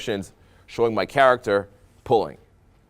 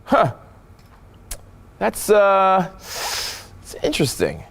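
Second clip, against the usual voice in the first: pondering interest; 10 semitones higher, much slower than usual. Shown as a demonstration. Coach showing another reaction to a girl, pondering. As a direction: puzzle over it before landing on "interesting". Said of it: "it's the way you".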